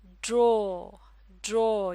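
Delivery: ड्रॉ said loud, with a long open vowel; demo word draw. Shown as a demonstration. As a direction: model ड्रॉ clearly. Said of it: "ये लफ्ज़ है".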